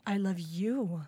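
soft low read. Shown as a demonstration. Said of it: "you can say a sentence a hundred ways".

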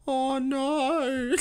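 high mock cry of dismay; goofy old woman's wail. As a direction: imitate the old lady's unforgettable wail. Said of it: "will always remember".

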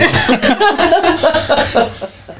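long high burst of laughter. Real, not acted. Laughing at own story.

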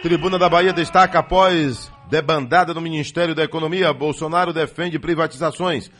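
loud, punchy headline reading; radio news read. Acted. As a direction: sell each headline like a morning radio anchor. Host reads the news headlines. (leitura de manchetes no rádio)

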